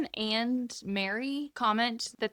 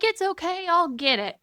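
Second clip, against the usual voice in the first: breezy Pa; 10 semitones higher, 6 dB louder than usual. Retold or imitated. Pa voiced climbing for kite.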